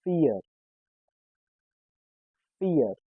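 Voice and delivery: Fear said as German "FEE-uh"; German pronunciation. Shown as a demonstration. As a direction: model Fear as vier, f and long ee. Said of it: "How do you say four?".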